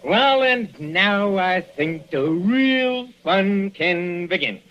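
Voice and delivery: slow, high gloating relish. Acted. Villain gloating to tied-up doctor, slow relish. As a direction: savour every word like a villain.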